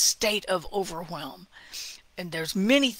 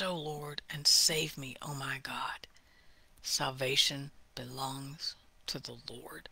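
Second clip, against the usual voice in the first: hushed prayer; 7 semitones lower, 8 dB quieter than usual. Acted. Praying quietly.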